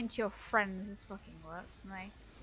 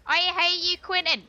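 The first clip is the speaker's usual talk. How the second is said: high, loud cry of gamer rage; exasperated yell; 5 semitones higher, 8 dB louder than usual. Real, not acted. Gamer yelling at rival Quentin, exasperated. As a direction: yell it at your tormentor.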